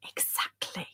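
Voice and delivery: soft, voiceless whisper; hushed, conspiratorial repeat; whispered agreement. Real, not acted. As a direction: whisper "Exactly" for emphasis.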